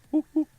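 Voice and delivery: very high, short hoot; owl hoot attempt. Shown as a demonstration. Streamer retrying an owl call, high hooting. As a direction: hoot high, without pushing it. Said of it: "that's not an owl either".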